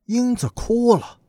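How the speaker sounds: ordinary delivery, nothing marked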